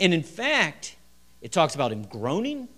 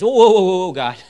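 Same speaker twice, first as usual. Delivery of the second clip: loud, much higher, recoiling "whoa"; a sudden loud higher-pitched whoa of alarm; startled protest; 5 semitones higher, 13 dB louder, faster than usual. Retold or imitated. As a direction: shout it like they're backing away from the stink. Sisters balk at opening tomb.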